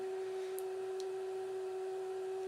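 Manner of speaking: helpless giddy laughing fit; long, soft giggle. Real, not acted. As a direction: giggle to yourself at your own silliness. Giddy fit while filming.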